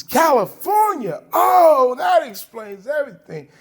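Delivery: high, loud scoffing cop; sarcastic cop shout. Retold or imitated. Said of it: "He says".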